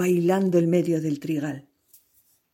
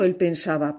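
ordinary delivery

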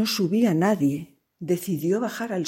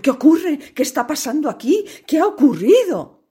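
alarmed voice; 9 semitones higher, 7 dB louder than usual. Acted. Family found crying in cellar.

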